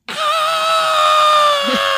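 high loud screaming dogs; dog scream imitation. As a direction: scream like a pack of Shibas. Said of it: "five Shibas all in unison just went".

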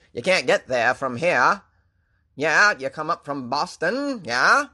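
hammed-up Down East Maine accent. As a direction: imitate a Maine local giving directions. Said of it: "I think Maine is terrible".

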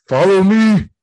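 loud, high shout to follow; urgent call. Retold or imitated. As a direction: yell for them to come along.

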